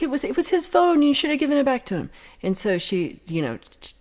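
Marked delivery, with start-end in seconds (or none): 0.0-2.1 high, fast mock insistence; imitating her insisting. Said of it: "And they're like".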